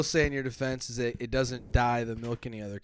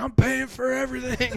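high, raised-voice mock proclamation; boastful announcement; 9 semitones higher, 4 dB louder than usual. Friend at end of night out, mock proclamation. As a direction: imitate a friend declaring it to the bar.

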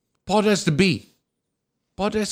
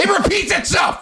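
high mock shout. Mocking a repeating headline.

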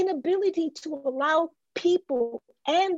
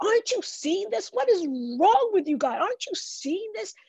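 exasperated prophet; 6 semitones higher, faster than usual. Retold or imitated. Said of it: "he goes to God and goes".

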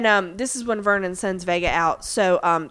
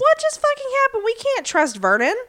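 outraged Peggy. Retold or imitated. Host retelling Peggy's outburst to co-host, high mock outrage. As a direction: throw up your hands in a hammed-up fit.

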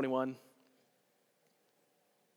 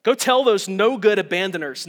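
higher, louder pretend rebuke; hammed-up disgust at deserters; mock scornful order; 4 semitones higher, 8 dB louder than usual. Jesus supposedly dismissing those who abandoned him, mock scorn.

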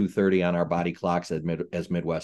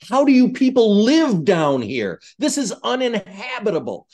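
retold shout; 12 semitones higher, 6 dB louder than usual. To a security man about the heat, loud exasperated shout. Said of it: "I was shouting at him".

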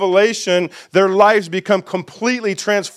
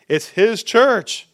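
slow, raised "his church"; "it's his church" drawn out high; stress on HIS; 4 semitones higher, slower than usual. Real, not acted. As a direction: land "his" firmly. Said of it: "but really".

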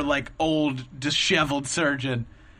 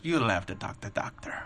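low, soft imitated Russian accent; a quiet hint of a Russian ultrasound tech; Boris; 6 semitones lower, 5 dB quieter than usual. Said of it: "as Borisy as possible".

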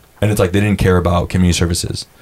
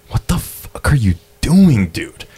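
exasperated; 5 semitones higher, slower than usual. Retold or imitated. Ranting about career politician.

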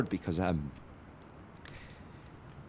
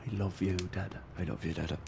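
cowed murmur; 5 semitones lower, 9 dB quieter, faster than usual. Acted. Cowed son.

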